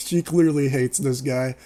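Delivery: usual speaking voice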